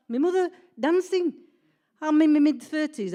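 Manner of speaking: loud, high exclamation of disbelief; amazed. Retold or imitated. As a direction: exclaim it as if it cannot be true. Amazed at mother dancing.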